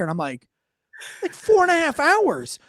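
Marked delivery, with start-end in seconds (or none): 1.2-2.6 high, loud, drawn-out disbelief; alarmed exclamation. Retold or imitated. Coworker telling the bar how long it's been, high alarmed cry. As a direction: blurt it out high in mock panic.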